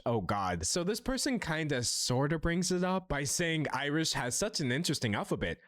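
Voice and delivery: normal talking voice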